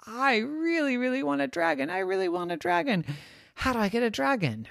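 put-on high, pleading child voice; high whiny kid wish; longing kid. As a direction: beg for a dragon up high. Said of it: "said his friends".

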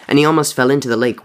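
usual speaking voice

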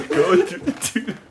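slow, high-pitched words through real laughter; laughing. Real, not acted. Podcaster repeating his joke sponsor slogan, laughing. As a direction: crack up while repeating the slogan.

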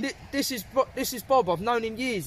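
high-pitched insistent mock manager; vouching manager. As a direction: imitate someone vouching for a mate, voice lifted high. Imitating manager vouching for Bob.

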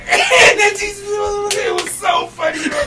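high, squealing words through real laughter; helpless laughing. Real, not acted. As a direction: barely get it out for laughing. Cracking up at own story.

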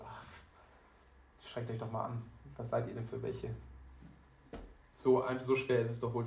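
low, soft, dismissive sigh at others; mildly proud. Acted. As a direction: imitate a slightly smug believer looking down on others. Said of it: "macht es einen ganz leicht stolz".

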